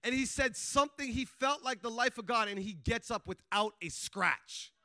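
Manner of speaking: usual speaking voice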